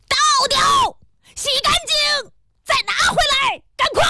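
furious high-pitched shouted orders; raging pharmacist. A narrator's reading. Pharmacist ordering the apprentice about, in a shrieking rage. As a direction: explode into piercing, high commands. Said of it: "药剂师暴跳如雷了".

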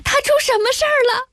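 very high, rapid alarmed question; loud cry. A narrator's reading. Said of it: "我大声说". (大声惊问，声调很高很急)

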